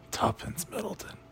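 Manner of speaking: ordinary delivery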